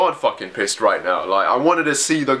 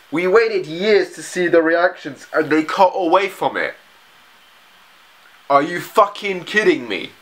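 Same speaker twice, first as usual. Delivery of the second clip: real exasperated rant; 4 semitones higher than usual. Real, not acted. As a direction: complain as if robbed of the payoff. Furious viewer rants at the show.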